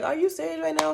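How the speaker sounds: imitating a friend going off; retold outburst. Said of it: "Lydia's going off like".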